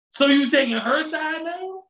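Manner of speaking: high, lightly voiced girlfriend turning it back on him; mock girlfriend. Girlfriend accusing boyfriend of taking a coworker's side, mock. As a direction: toss it back like an offended girlfriend.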